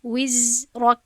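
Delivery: وزرک recited loudly with a held, buzzing ز; flowing za. Shown as a demonstration. Teacher showing a rikhwah letter, lengthened z. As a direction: let the ز in وزرک flow before the next syllable. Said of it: "چون که این حرفی رخوه هست".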